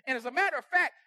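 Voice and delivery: ordinary talk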